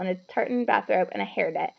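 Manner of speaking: usual speaking voice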